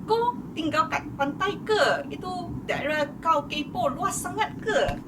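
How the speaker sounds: high-pitched, teasing jab at the nosy critics; sassy rant. Real, not acted. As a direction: needle them, pitch up and cheeky. YouTuber ranting at haters in video. (sindiran nada tinggi kepada pengkritik)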